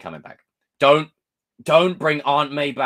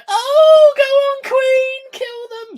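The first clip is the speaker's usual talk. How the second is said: shrill, very high, loud fangirl squeal cheering the queen on; mock fan squeal; 12 dB louder, much slower than usual. Mocking Wanda fans cheering her killing spree, squealing high. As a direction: ham up a screaming stan, pitched way up. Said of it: "and all the stands go".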